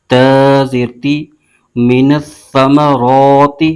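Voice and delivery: slow, drawn-out chanted spelling of letters and vowel marks; Quran spelling drill. Shown as a demonstration. Teacher spelling a Quran word for students, slow recitation chant. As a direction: chant each letter and mark slowly, like a tajweed teacher. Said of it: "اب اس کے ہجے یوں کریں گے".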